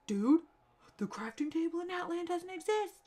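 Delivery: high, indignant disbelief; exasperated complaint. Retold or imitated. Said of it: "and I was like".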